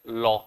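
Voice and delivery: लॉ between ला and लो; half-open law. Shown as a demonstration. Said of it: "ला से क्या हो जाएगा". (ला और लो के बीच लॉ)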